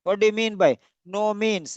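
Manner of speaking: usual speaking voice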